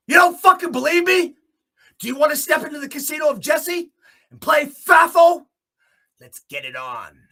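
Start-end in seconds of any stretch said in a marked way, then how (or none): 0.1-5.5 high and loud challenge; taunting shout. Real, not acted. Challenging the board to play with him, loud taunting. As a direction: dare them loudly.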